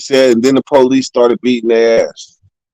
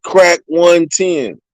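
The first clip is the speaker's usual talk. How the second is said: slow menacing order; 4 semitones higher, much slower than usual. Retold or imitated. Officer ordering the cell opened, slow and menacing. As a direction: Crack one ten, drawn out slow and deliberate.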